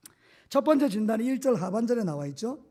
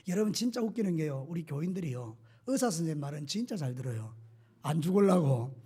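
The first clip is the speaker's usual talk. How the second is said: usual speaking voice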